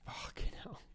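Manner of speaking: breathy whispered swear under the breath; whispered curse. Real, not acted. Gamer whispering a curse at a bad round. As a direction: curse it quietly to yourself.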